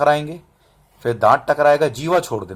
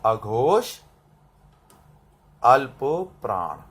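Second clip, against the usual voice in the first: dictation; 4 semitones lower, 4 dB quieter, slower than usual. Shown as a demonstration. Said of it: "यहां लिखो".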